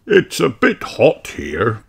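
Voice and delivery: slow, slightly breathless moan; mildly puffed and slowed; panting. Acted. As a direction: act worn out by the heat. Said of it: "panted Daddy Pig".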